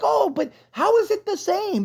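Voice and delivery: high mocking whine, imitating a defender. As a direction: mimic the critics in a high, sneering voice. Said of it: "people trying to justify it".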